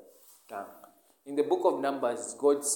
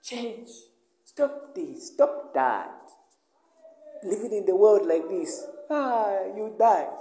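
mock scolding preacher, higher; imitating a nagging preacher; 7 semitones higher, 6 dB louder, slower than usual. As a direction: parody the preachers who lectured him. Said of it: "people used to preach to me".

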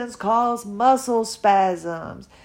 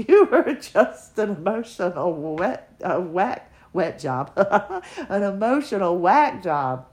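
laughing at herself. Retold or imitated. Mocking her future self, laughing.

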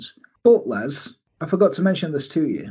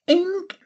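high squeaky wink click; mock wink sound. Gunness winking at the camera, squeaky wink noise. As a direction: make a cartoon wink noise. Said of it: "gives this wink".

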